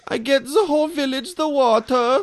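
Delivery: high, loud mock foreign accent; Jordan's bad accent. Host still mocking guest's accent, hammed-up foreign voice. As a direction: proclaim it in a cartoonish foreign accent. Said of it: "What the fuck is that accent?".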